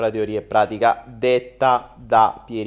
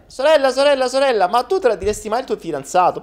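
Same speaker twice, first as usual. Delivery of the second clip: put-on childish boy voice; 12 semitones higher, 5 dB louder, faster than usual. Boy pesters his sister.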